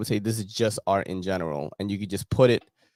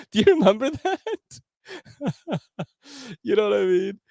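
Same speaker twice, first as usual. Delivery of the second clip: high-pitched laughing question; giggling disbelief at egg prices; 14 semitones higher than usual. Real, not acted. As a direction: laugh through the words, amused.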